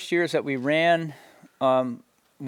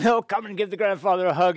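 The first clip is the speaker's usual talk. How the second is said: grandfather's voice; 6 semitones higher than usual. Retold or imitated. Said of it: "they'd all be crying and hugging him".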